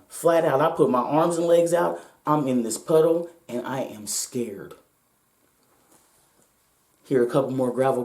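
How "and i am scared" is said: slow, much softer, frightened admission; hushed fear. Retold or imitated. Lying in the puddle at gunpoint, slow hushed fear. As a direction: let the fear drain the voice. Reliving being held at gunpoint.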